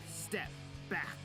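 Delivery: plain reading voice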